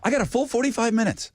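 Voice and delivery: high, quick, eager elf voice; Buddy the Elf. Retold or imitated. Co-host teasing a friend who slept in, high eager elf impression. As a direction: rattle it off high like an overexcited elf. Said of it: "You are like Buddy the Elf".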